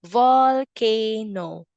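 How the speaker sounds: "Volcano" stretched slowly, each syllable clear; teacher slowing "Volcano" so kids find the V; slow modeled word. Shown as a demonstration. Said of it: "What about volcano?".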